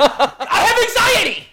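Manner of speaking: very high, loud drawn-out shriek; hammed-up hysterical scream; panicked yell. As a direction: wail it at the doctor in a panic. Acts out yelling at the doctor.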